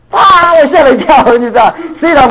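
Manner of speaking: high, loud startled outburst; high, loud shocked exclamation. Real, not acted. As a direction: jolt up in real shock, a bit fast.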